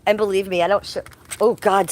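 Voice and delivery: ordinary talk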